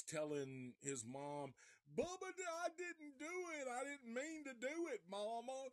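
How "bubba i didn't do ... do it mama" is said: Bubba. Retold or imitated. Bubba telling his mom he didn't do it, high pleading imitation. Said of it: "that's how he fucking sounded".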